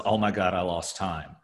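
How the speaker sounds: ordinary delivery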